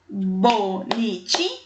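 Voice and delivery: "bonitinha" spoken slowly as bo-ni-ti-nha to find the last syllable. Shown as a demonstration. Teacher finding the final syllable for pupils, slow syllables. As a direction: drag out each syllable of "bonitinha". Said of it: "Sílaba final".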